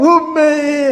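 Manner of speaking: put-on high baby call for mom; baby cry. Imitating a baby calling mom. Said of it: "하면은 엄마가 딱 와서".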